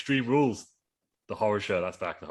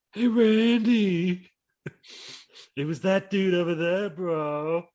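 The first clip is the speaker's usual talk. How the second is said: much higher, slow, put-on voice calling out; put-on caller voice; 10 semitones higher, much slower than usual. Riding past on a scooter, calling to Randy in a high put-on voice. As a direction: hail him from across the way in a high silly voice.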